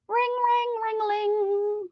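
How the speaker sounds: high sing-song ring ring-a-ling; phone ring imitation. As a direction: chirp it like a ringing phone. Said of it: "calling you on the ring-a-ling".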